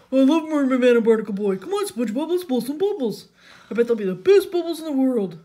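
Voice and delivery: loud, high, excited whoop; Patrick. A narrator's reading. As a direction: bubble over with noisy enthusiasm. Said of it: "jumped up and down with excitement".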